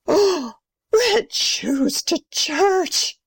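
high, very slow, scandalised gasp; still gasping. Acted. Grandmother repeats the scandal to neighbours, gasping. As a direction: gasp and stretch out each shocked word. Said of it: "Still gasping".

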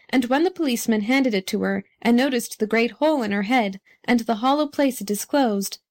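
usual speaking voice

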